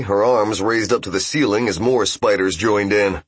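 ordinary delivery

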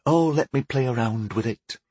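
mildly brushing it off; understated, offhand dismissal; dismissive. Acted. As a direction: wave the question away lightly. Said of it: "he dismissed".

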